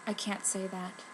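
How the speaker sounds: too shy to admit it. Acted. Shy girl hiding a crush.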